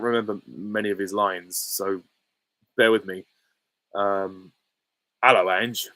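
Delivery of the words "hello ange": lightly voiced Cockney impression; Cockney accent. Streamer trying an impression from few remembered lines, light Cockney accent. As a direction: try out a bit of Cockney. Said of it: "It's just a Cockney accent".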